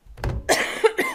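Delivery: sharp real cough. Real, not acted. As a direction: cough once, hard. Teacher coughs mid lesson.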